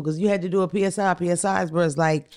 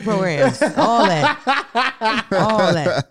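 laughing; 4 semitones higher, much slower than usual. Real, not acted. Laughing during story.

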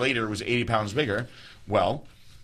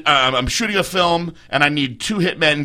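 normal talking voice

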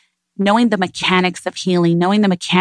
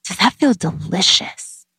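gentle mother; 6 semitones higher than usual. Retold or imitated. Mother asking toddler about food.

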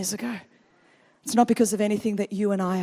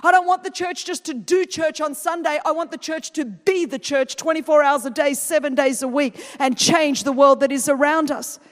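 preaching at full force; 6 semitones higher, 5 dB louder than usual. Real, not acted. Preaching to the congregation.

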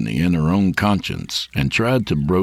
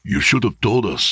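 father's hasty, slightly higher retort; quick reply; 6 semitones higher than usual. A narrator's reading. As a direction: blurt it as a mildly reproachful father. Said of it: "Domingo said quickly".

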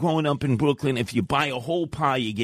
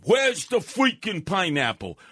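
mock blustery anger; 8 semitones higher than usual. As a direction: play the outraged customer demanding pineapple. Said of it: "I start getting all blustery and angry".

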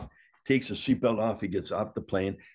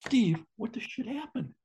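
shocked question. Retold or imitated. The speaker shocked at seeing Mad Dog.

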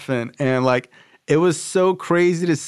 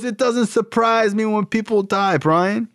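very high-pitched, emphatic imitation; impassioned rant; 9 semitones higher than usual. Sponsor telling young caller that addicts die, impassioned rant. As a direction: push the voice way up, drive each phrase home. Sponsor rants in retold phone call.

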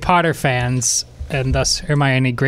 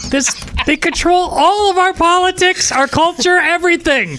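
high, loud, hammed-up alarmed voice; mock conspiracy voice; 4 dB louder than usual. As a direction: play it up like a mock panic. Mocking Russian bot takeover.

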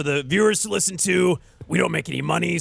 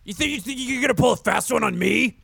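mock outrage; 8 semitones higher than usual. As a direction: yell at a friend in fake anger.

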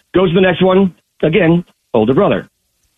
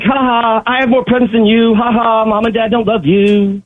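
put-on high, gloating kid's singsong; taunting older brother; 6 semitones higher than usual. Caller telling a joke, a high taunting big brother gloating over presents. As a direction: lord it over your little brother, sing it out.

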